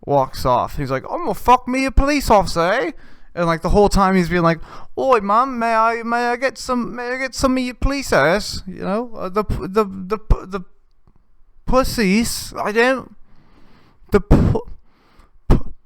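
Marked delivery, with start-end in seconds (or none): 1.1-2.9 high, loud put-on Australian accent, smug; Australian accent impression. Comic voicing the skit guy bragging, in a high loud Australian accent. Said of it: "this is full Australian". 5.0-15.7 high put-on Australian accent, stumbling; Australian accent impression. Comic voicing the skit guy's crude pickup line, in a high Australian accent. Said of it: "this is full Australian".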